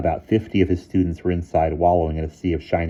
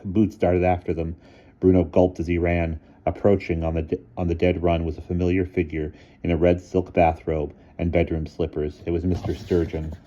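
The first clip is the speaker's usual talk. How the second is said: usual speaking voice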